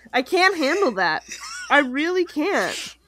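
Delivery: ordinary talk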